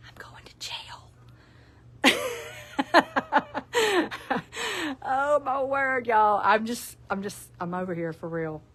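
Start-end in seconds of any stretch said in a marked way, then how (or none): 0.1-1.1 breathy whisper on the punchline; conspiratorial whispered aside. Acted. Punchline of towel joke read. 2.0-5.0 long high cackling laugh; laughing hard at the joke she just read; high burst of laughter. Real, not acted. Cracks up at own towel joke.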